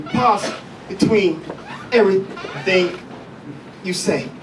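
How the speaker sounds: halting delivery with a pause between words; slow and broken up, stopping after every word or two; Captain Kirk style. Shown as a demonstration. Comic showing a stuttering type, halting Captain Kirk style. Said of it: "Captain Kirk style".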